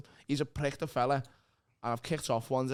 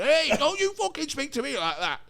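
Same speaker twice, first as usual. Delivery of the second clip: higher, louder mock telling-off; angry boss; 11 semitones higher, 5 dB louder than usual. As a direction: snap back like an offended boss. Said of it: "And he's went".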